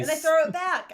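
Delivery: excited. Real, not acted. Excited player declares action.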